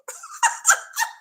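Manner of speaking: high burst of laughter; shrieking laugh. Real, not acted. Laughing at her own rope-swing joke, high squeal. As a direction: let out a squealing cackle.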